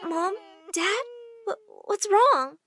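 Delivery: put-on worried child. Daughter finding her parents crying, worried. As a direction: ask it slowly with a small stammer.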